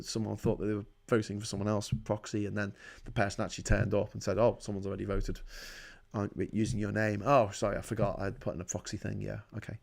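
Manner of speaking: low, soft, quick mumble; quiet hurried retelling. Retold or imitated. Vlogger retells the proxy mix-up, low and fast. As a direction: rattle it off quietly.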